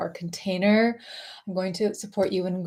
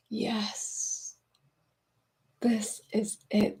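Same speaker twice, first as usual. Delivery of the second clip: soft, near-whispered affirmation; hushed realisation; 8 dB quieter, much slower than usual. Acted. Host voicing what viewers will feel by the end, hushed. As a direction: let it out very softly, with space between phrases.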